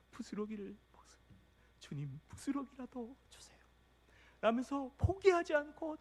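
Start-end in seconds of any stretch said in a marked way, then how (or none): 0.1-3.6 pleading whisper. Acted. Canaanite woman begging Jesus.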